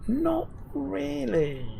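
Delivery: very high, slow, stretched-out reluctance; drawn-out hesitation. Real, not acted. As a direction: stretch it out high, doubtful about the answer. Hesitant answer to viewer's question.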